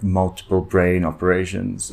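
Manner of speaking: normal talking voice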